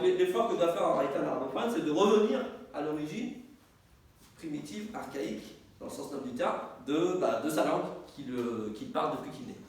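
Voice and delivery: ordinary talk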